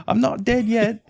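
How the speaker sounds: high British peasant voice; hammed-up high British accent; Monty Python British. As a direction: squeak it like the Monty Python plague cart man. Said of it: "cancelable accents".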